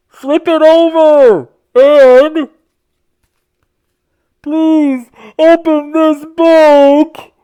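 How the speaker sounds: high, loud urgent pleading; dramatic pleading. A narrator's reading. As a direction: call out begging the reader.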